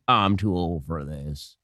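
put-on low, slow, quiet old senator voice; Mitch McConnell. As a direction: croak it out like a tired old senator. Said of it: "So Mitch McConnell, who's like".

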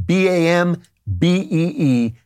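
slow, letter-by-letter spelling; spelled out. Shown as a demonstration. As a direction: draw out each letter, B A M B E E, so it can be written down.

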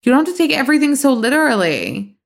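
defensive. Real, not acted. Defending herself to co-host.

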